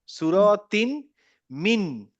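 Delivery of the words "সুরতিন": সুরতিন drawn out slowly, rising at the end; slow recitation. Shown as a demonstration. Teacher to student, slowly reading সুরতিন. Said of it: "এখানে দেখেন".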